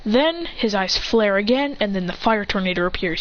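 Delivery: ordinary delivery, nothing marked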